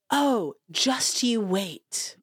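slow, drawn-out knowing warning; mock a smug know-it-all, slowly; condescending quote. Mocking people who dismiss parenting complaints, slow and smug. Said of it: "they'll be like".

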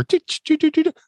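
high, rapid little toots; high-pitched ticker noise; imitated news ticker. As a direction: mimic a radio news jingle. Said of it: "They had the news ticker".

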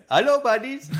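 high, bright mock greeting of the store guys; imitating shopkeeper greeting. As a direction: chirp it like the friendly shop clerks. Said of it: "they smile when they see us".

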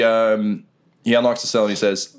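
ordinary talk, nothing marked

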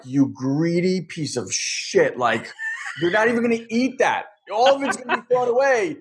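venting in frustrated disbelief, climbing high; retold fit of silent outrage, voiced; angry inner outburst. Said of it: "I'm so angry. I'm so frustrated".

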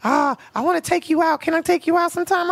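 put-on high, eager young boy; little boy voice. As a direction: gush like a smitten boy. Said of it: "That was my little boy's voice".

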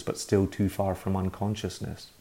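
usual speaking voice

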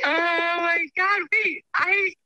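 high, slow stunned exclamation; shocked. Real, not acted. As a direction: gasp it out in disbelief. Shocked by abandonment story.

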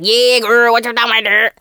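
trying too hard to fit in; overdone imitation slang. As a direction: lay on the slang, forced and unnatural. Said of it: "they're going extra and above and beyond".

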